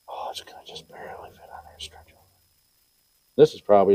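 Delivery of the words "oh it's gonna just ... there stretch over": very soft fast whisper; hushed self-talk. Real, not acted. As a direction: whisper it to yourself. Mutters to himself while fitting part.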